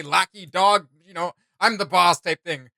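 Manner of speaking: normal talking voice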